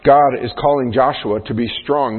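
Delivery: ordinary talk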